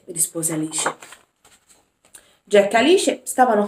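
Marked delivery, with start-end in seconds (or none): none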